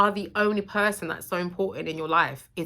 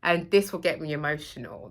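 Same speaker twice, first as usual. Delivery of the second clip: ordinary talk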